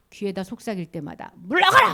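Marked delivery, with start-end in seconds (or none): 1.3-1.9 loud, high cry of command; acted shout. Jesus shouting at each whisper of Satan, commanding shout. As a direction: yell it as a banishing order. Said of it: "하고 소리 질러주는 거예요".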